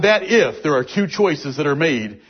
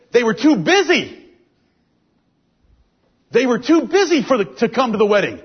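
preaching fervor; 5 semitones higher than usual. Acted. Preaching, rising pitch.

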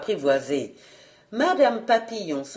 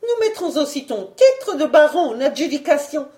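high, loud threat; vindictive wife; 6 semitones higher, 5 dB louder than usual. Acted. Wife threatens to auction her husband's title, high and defiant.

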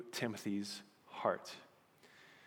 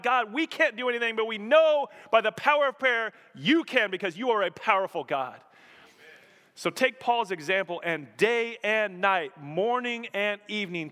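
high and loud, driving each never; full-force preaching; 8 semitones higher, 6 dB louder than usual. Real, not acted. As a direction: preach it out to the whole room.

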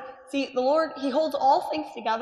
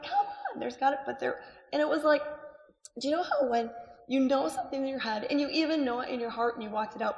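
ordinary talk, nothing marked

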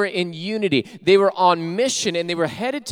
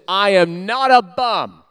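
mock beggar's insistence; 6 dB louder, slower than usual. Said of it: "the guy says".

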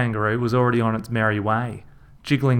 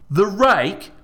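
higher, louder, slow exasperated bloke; Reg's Aussie voice; 10 semitones higher, 5 dB louder, slower than usual. A narrator's reading. Reg berating his brother about the kids. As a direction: scold him like a fed-up brother.

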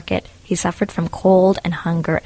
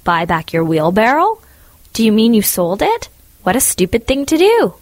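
shocked scolding; 4 semitones higher, 5 dB louder than usual. Acted. Miller scolding Hans's sale.

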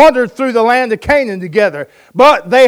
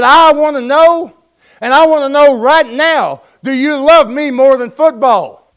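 loud, insistent demand from an exasperated wife; fed-up wife's demand; 5 semitones higher, 5 dB louder than usual. Retold or imitated. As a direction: plant your feet and demand an answer. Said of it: "And she said".

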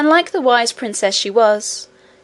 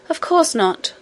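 cartoon princess; 6 semitones higher than usual. Princess Irene replying to Curdie.

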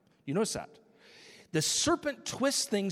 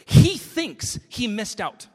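slow, louder, higher preaching push; loud proclamation; 7 semitones higher, 13 dB louder, slower than usual. Real, not acted. Preacher proclaiming to the congregation, loud and slow. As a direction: drive the point home with force. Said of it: "preacher proclaiming loudly".

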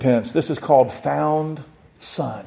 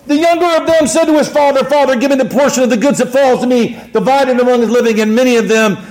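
high, forceful declaimed reading; preacher's scripture reading; 8 semitones higher, 6 dB louder than usual. Acted. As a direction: read the parable out to the back row. Reads scripture in raised voice.

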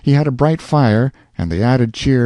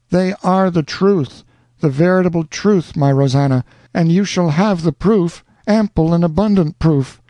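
higher, earnest lover; Alonzo, ardent; 8 semitones higher than usual. A narrator's reading. Alonzo assuring Rosanna on the telephone, ardent. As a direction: promise with fervour.

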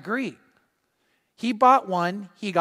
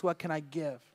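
much softer, gentle thought; wife's thought; 5 semitones lower, 11 dB quieter than usual. Retold or imitated. Pam thinking as they pass someone, soft. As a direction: think it softly to yourself.

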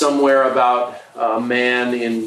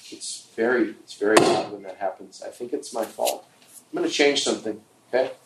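trailing off; 3 semitones lower, 6 dB quieter, faster than usual. Real, not acted. Aside while fixing equipment.

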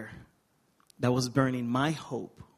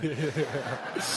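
laugh. Real, not acted. Joke about hating cornbread.

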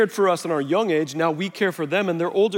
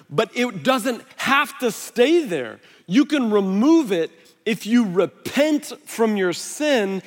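lifted, louder sermon push that slows at the end; fervent preaching; 4 semitones higher, 3 dB louder than usual. Acted. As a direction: preach it with conviction. Preaching with rising intensity.

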